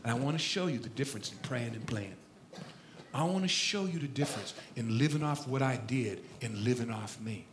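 low, very soft fatherly voice; hushed, deep, patient fatherly counsel; God as father. Retold or imitated. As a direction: instruct your son quietly from deep down. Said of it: "He says".

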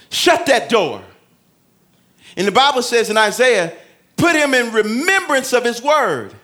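loud, high, driving preacher; full-force preaching. Acted. Pastor urging the church to pray in private, loud and high. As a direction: preach it out to the congregation.

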